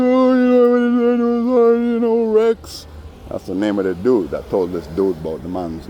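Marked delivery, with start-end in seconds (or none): 0.0-2.9 slow, very high falsetto whine, much louder; mock whiny grief. Teller imitates the man grieving for Rex, slow falsetto whine. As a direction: drag it out, squeaky and sorry for himself. Mocking the guy's fake grief.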